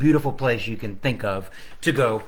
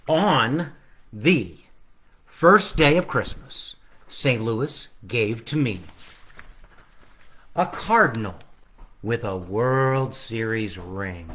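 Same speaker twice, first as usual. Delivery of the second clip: slow, sing-song storybook reading; reading to children; 2 semitones lower, slower than usual. Acted. Author reading his Christmas book to children online. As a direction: read it out slowly for the kids.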